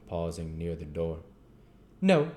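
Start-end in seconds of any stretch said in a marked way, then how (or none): 2.0-2.3 high, loud flat refusal; a blunt one-word no; curt chairman. Acted. Limbkins refuses Gamfield, high and loud. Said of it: "replied Mister Limbkins".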